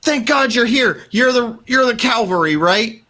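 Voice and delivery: high, shaky voice, relieved and babbling; a survivor thanking the posse; frantic survivor. Acted. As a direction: gush with relief at being rescued. GM holds panicky NPC voice.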